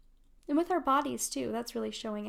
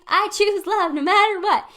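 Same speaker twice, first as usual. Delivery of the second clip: high, loud, slow chant for everyone; group affirmation; 11 semitones higher, 12 dB louder than usual. Shown as a demonstration. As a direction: lead the crowd in the affirmation. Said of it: "Say it with me, everybody".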